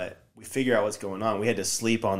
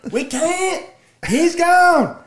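high, loud hopeless yell; Danny yelling back that they can't, high and loud; panicked shout; 9 dB louder, much slower than usual. Retold or imitated. Danny's reply, retold.

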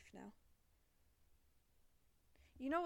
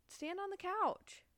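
high, slow urgent cry; screaming at a spider; 10 semitones higher, 7 dB louder, slower than usual. Real, not acted. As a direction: call out in alarm, high.

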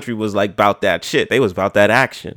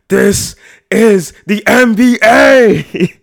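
slow, loud, higher epic roar over something trivial; mock Leonidas bellow; 9 semitones higher, 7 dB louder, much slower than usual. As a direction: shout it like a battle cry. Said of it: "And you'd be like".

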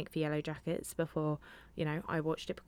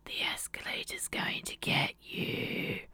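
breathy creepy whisper, high and eerie; spooky whisper. Retold or imitated. Mum teasing her scared daughter, spooky whisper. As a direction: hiss it like a ghost story threat.

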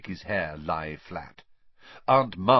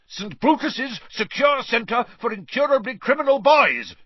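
much higher, louder panicked bellow; 7 dB louder than usual. A narrator's reading. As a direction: blast the full name in a panic. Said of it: "bellowed Uncle Vernon".

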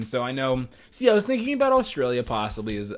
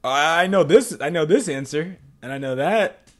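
louder, higher eager burst of confidence; eager know-it-all; 4 semitones higher, 6 dB louder than usual. Acted. Host quoting themselves when asked a geography question, eagerly confident. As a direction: blurt it out, sure of knowing the answer. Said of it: "people are asking me, like, geography questions, and I'm like".

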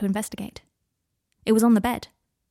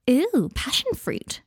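delighted; 7 semitones higher, much slower than usual. Acted. Adelaide delighted by tea.

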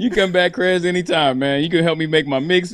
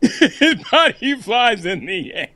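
slow words through laughter; laughing; 6 semitones higher, slower than usual. Real, not acted. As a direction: laugh out each word. Retelling headline, cracking up.

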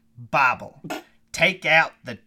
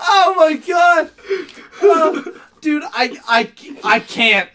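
high and strained from laughing; after laughing hard; 12 semitones higher, 7 dB louder than usual. Real, not acted. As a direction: gasp it out, laughed out. Overcome with laughter.

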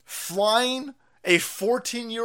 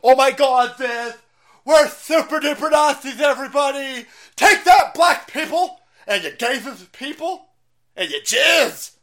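high, loud cartoon proclamation; hammed-up shouting, over-eager and dopey; mocking caricature; 6 semitones higher, 8 dB louder than usual. Host mocking staged masked marchers with flags, hammed-up. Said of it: "And like".

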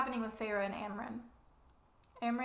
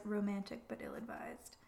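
menacing whisper; 7 dB quieter than usual. Acted. The king mocking Nesta.

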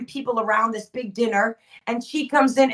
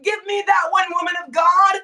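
shouted refusal; 6 semitones higher than usual. Acted. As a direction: shout it out to the woman, refusing her burden. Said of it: "As we say".

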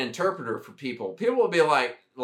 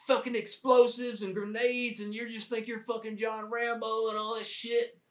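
lightly voiced gruff sergeant major, higher; mock the old sergeant major confronting the platoon; 7 semitones higher than usual. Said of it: "he's like".

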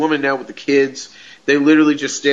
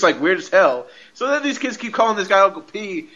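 ordinary talk

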